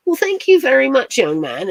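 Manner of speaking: put-on English accent, starting high and loud; high English opening, dropping at the end. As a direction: thank them in a mock English voice. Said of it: "He probably thinks I'm English".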